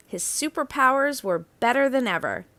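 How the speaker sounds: ordinary delivery, nothing marked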